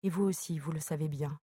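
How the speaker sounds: ordinary delivery, nothing marked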